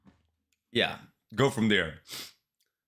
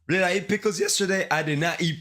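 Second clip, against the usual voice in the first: surprised; 8 semitones higher than usual. Real, not acted. Sudden realization mid-chat.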